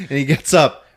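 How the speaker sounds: ordinary talk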